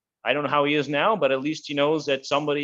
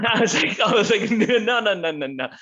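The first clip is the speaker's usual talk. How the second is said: high-pitched, laughing through a mock refusal; laughing retelling; 9 semitones higher than usual. Laughing while retelling his alarmed refusal.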